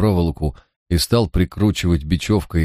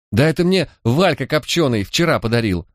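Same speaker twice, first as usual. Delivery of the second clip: cried out; 8 semitones higher than usual. A narrator's reading. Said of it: "закричала Манька".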